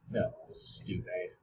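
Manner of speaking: soft, slow whispered mutter; whispered self-scold. Real, not acted. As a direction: scold yourself under your breath.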